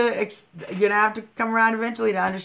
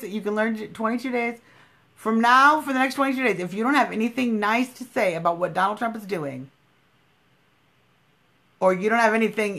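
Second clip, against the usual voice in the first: ordinary delivery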